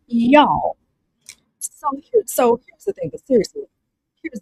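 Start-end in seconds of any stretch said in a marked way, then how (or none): 0.1-0.8 a playful Southern "Y'all"; drawled Southern y'all. Shown as a demonstration. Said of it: "Say that".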